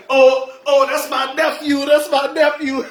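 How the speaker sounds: high, loud, proud aunt exclaiming through laughter; excited aunt. Retold or imitated. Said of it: "I can hear my A T saying".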